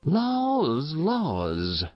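high sighed lament falling away; sighing laws. A narrator's reading. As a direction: pity sighed out. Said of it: "sighed Mrs. Race".

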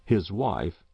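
ordinary delivery, nothing marked

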